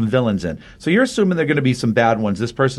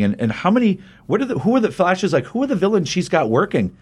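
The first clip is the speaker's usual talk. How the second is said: higher-pitched, mildly acted bafflement; retold incredulous questioning; 7 semitones higher than usual. Flash asking who the villains are, retold incredulity. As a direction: stumble through worked-up questions in a raised pitch.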